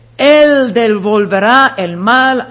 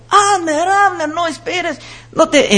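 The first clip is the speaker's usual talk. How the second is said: high call of a name, over and over; calling out repeatedly; 7 semitones higher than usual. Retold or imitated. David calling Abner who sleeps on, repeated high call. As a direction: call the name as if nobody answers. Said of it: "Empieza así, como que estaba ahí mucho tiempo".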